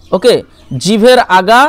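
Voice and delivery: ordinary delivery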